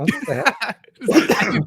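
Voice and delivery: mock shaken what the hell; shocked outburst. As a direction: imitate your own stunned disbelief. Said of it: "I'll go".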